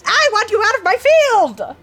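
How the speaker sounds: high, loud screech; hammed-up, high-pitched creature rage; furious creature. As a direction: shriek at the intruders to leave. Creature orders intruders out.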